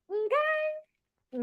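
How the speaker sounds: very high, drawn-out okay; sassy okay. Real, not acted. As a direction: pitch it way up and stretch it. Sassy echo after telling someone off.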